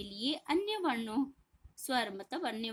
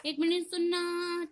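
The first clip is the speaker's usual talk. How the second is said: higher call with ना held long in सुनना; drawn-out call; 6 semitones higher, 3 dB louder than usual. Shown as a demonstration. Teacher calling someone from afar, last vowel drawn out. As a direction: call out, stretching the end of सुनना. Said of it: "ज्यादा लंबा खींचता है".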